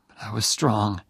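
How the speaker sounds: ordinary delivery, nothing marked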